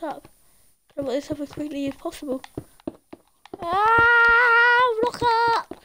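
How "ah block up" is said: long high scream into a command; panicked yell. Real, not acted. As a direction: cry out loudly under attack. Panicking while blocking the base.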